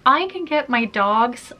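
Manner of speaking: normal talking voice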